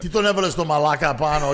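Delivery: loud mock-angry complaint; hammed-up jealous rant; mad bandmate. Jealous singer griping about the comic, loud. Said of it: "He goes".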